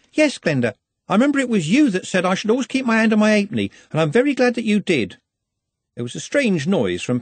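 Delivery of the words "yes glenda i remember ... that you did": put-on higher, eager apologetic girl's voice; nervous young Juliet rushing her excuses; anxious young girl. A narrator's reading. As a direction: babble it out like a flustered young girl.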